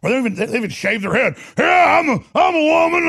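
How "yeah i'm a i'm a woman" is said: mocking. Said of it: "'Cause dudes go".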